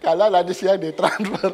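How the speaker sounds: high, loud, laughing throughout; laughing joke. Real, not acted. Pastor jokes about the money transfer, laughing loudly. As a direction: crack up while teasing.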